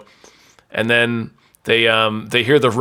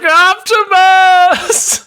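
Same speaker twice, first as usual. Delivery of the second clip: anguished cry; 6 dB louder, much slower than usual. Acted. Sam cries out dying.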